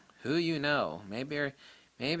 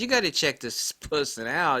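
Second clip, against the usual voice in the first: raised, louder Boston voice; Boston accent; 4 semitones higher, 5 dB louder than usual. Retold or imitated. Boston caller passing on a tip, imitated Boston accent. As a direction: put on an emphatic Boston accent. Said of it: "with his Boston accent".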